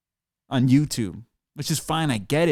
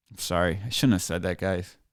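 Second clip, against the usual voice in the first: the anger drained, mumble sorry to the viewers; sheepish quiet apology; 9 semitones lower, 6 dB quieter than usual. Real, not acted. Ranter apologizing to his viewers, suddenly much softer and sheepish. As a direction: drop much softer and lower, backing down. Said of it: "I just get so mad sometimes".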